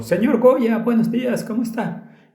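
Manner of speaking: tiny, high-pitched voice, pitched far up; small high-pitched voice. Retold or imitated. Possessed girl greeting the pastor, small high-pitched voice. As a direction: imitate a possessed girl's little high voice. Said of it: "su pequeño tono agudo".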